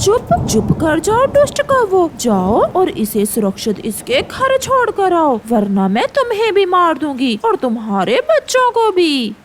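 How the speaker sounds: high, loud furious threat; angry hawk. Acted. Hawk threatens the wicked crows to take the sparrow home, angry shout. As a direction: scold them like you mean to kill.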